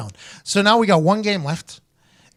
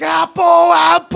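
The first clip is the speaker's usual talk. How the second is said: high, loud, drawn-out call; stretched shouted signal; quarterback audible; 11 semitones higher, 7 dB louder, slower than usual. Retold or imitated. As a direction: holler the check to the team. Acts out quarterback checks.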